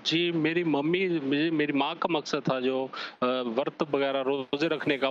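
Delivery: ordinary talk, nothing marked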